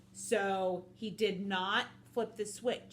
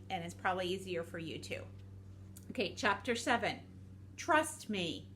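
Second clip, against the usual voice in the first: plain reading voice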